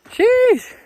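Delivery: high loud sheesh; excited exclamation. Real, not acted. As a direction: exclaim at the view. Amazed at the view.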